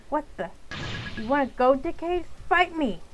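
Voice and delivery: put-on a girl daring the bully to fight; angry challenge.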